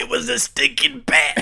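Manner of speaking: high, loud squeaky goon; high cartoon henchman. Comic henchman voice.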